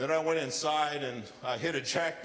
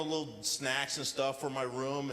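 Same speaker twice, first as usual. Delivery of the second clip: normal talking voice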